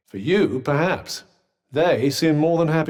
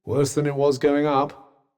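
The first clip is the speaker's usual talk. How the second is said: ordinary talk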